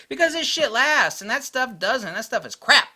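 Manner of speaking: loud, high-pitched exasperated yelling; exasperated rant. Real, not acted. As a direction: yell the answer like you're sick of explaining. Exasperated answer in his rant.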